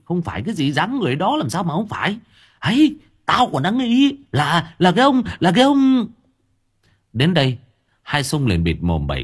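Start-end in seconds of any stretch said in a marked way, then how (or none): 0.0-6.1 much higher and louder, rushing then slowing; agitated blurt. Acted. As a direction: blurt out his suspicion before he's silenced. Agitated old man blurting suspicion.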